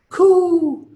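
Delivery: high dove call; dove coo. Acted. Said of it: "One was a dove".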